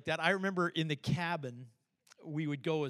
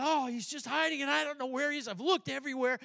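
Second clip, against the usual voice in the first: put-on kid; 8 semitones higher, faster than usual. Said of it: "And he went".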